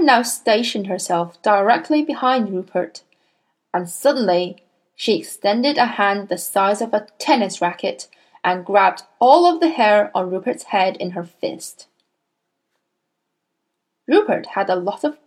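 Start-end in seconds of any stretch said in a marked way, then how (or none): none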